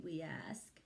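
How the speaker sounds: plain reading voice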